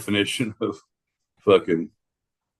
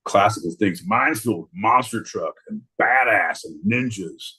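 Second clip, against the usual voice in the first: ordinary talk, nothing marked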